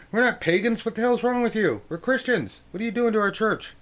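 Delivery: high, loud offended yelling; indignant outburst. Acted. Christians yelling at the man with the hammer, high and outraged. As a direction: shout in shocked outrage.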